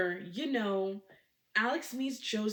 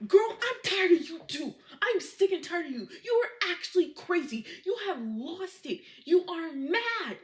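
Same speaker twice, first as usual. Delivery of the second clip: angry yelling; 8 semitones higher, 6 dB louder than usual. Retold or imitated. Husband yells back in argument.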